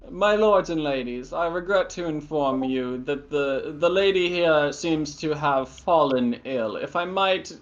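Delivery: lightly voiced tall proper butler; a hint of a formal, stiff-upper-lip servant. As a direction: announce it like a dignified butler. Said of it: "and he says".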